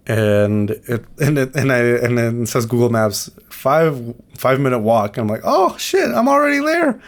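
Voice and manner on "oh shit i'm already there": much higher, startled realization; shocked surprise. Retold or imitated. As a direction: squeal it like a sudden discovery. Google Maps says five minutes.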